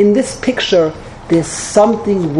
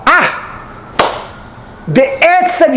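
high, gushing mock congratulations to the bride; tactless gushing guest; 6 semitones higher than usual. Wedding guest to the bride, gushing and tactless. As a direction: overeager guest, much higher, blurting bad news cheerfully. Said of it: "Is that how you talk?".

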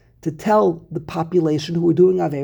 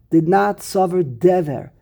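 usual speaking voice

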